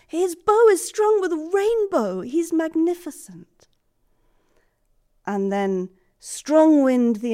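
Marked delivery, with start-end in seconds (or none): 0.1-3.7 awestruck wonder. Acted. Awestruck describing Strongwind.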